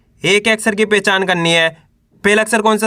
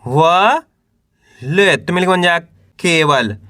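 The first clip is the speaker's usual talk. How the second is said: drawn-out letters with pauses between; teacher's spelling drill, held rising syllables; syllable-by-syllable sounding out; slower than usual. Shown as a demonstration. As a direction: sound out each letter for beginners to repeat. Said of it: "तो कैसे पढ़ेंगे".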